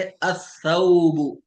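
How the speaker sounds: अथौबु slow, lam silent, tha doubled; drawn out अथौबु with no l; Arabic sun letter demo. Shown as a demonstration. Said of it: "सा से जैसे".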